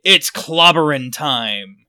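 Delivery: slow, loud catchphrase; Thing's catchphrase. Retold or imitated. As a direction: belt out the Thing's battle cry. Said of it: "his patented".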